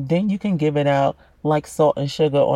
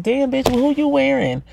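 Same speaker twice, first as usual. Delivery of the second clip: higher-pitched, gushing mock admiration; impressed onlooker; 7 semitones higher than usual. Onlooker asking about a designer outfit, impressed. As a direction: play a nosy admirer, voice lifted.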